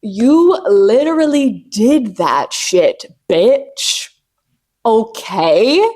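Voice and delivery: slow, sassy hype voice; slow sassy pep talk to herself; self hype. Retold or imitated. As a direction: praise yourself like a proud friend. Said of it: "I stop to be like".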